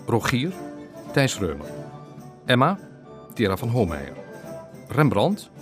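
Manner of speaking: ordinary talk, nothing marked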